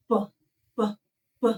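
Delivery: B puffed out hard, the wrong way. Shown as a demonstration. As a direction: blow out a hard B as a mistake. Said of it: "we don't want to make a sound like".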